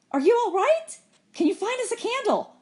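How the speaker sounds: high-pitched, urgent questions; anxious calling. A narrator's reading. Girl to companion in the dark boat, anxious calling. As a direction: call across, pitched high with concern.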